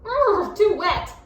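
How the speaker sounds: high, loud panicky squeak. A narrator's reading. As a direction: squeak as the water rises. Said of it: "squeaked the gingerbread man".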